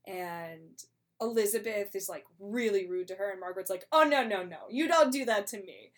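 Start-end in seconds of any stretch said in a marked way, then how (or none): 3.9-5.9 louder, sharp mock-offended no no no; indignant retort. Margaret snapping back at rude Elizabeth, loud indignant. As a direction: push back hard at the insult.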